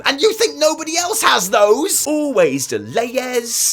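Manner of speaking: high, loud irate forum complainer; mock angry rant. Player ranting at the developers about delays, mock angry. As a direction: ham up an outraged complaint.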